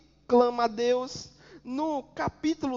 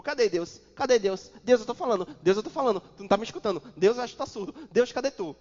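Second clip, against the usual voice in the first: loud, impatient mock complaint to God; reclamação impaciente; 6 dB louder, much faster than usual. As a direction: nag God like a sulking child. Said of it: "Abacuque não ficou". (reclamando alto com Deus, impaciente)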